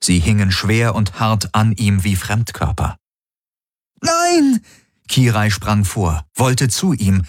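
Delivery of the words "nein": very high-pitched scream of refusal; horrified cry. A narrator's reading. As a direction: scream out in horror. Kirai horrified, leaping forward. (hoher, entsetzter Aufschrei)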